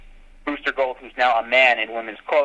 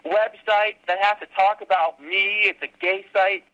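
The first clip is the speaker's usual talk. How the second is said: higher, mock-exasperated complaint; 8 semitones higher than usual. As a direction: groan at the bad luck. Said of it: "I'm like".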